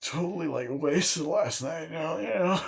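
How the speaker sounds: high-pitched goofy teen impression; Stu. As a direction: babble like a silly party boy. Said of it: "Stu was kinda being".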